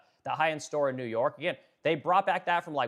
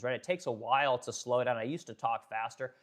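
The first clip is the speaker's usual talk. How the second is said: ordinary delivery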